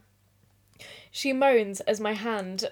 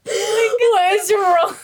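laughing; 10 semitones higher, 7 dB louder, much slower than usual. Real, not acted. Laughing fit while reading aloud.

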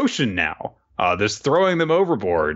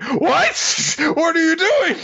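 high, startled squeak of disbelief; high-pitched startled yelp; shocked Gen; slower than usual. Retold or imitated. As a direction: yelp it in alarm.

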